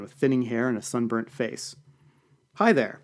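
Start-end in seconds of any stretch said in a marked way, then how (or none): 2.5-3.0 bright, higher cheerful greeting; cheerfully. Acted. Party guest greeting a stranger, cheerful and friendly. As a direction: greet him like an old friend. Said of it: "he said cheerfully".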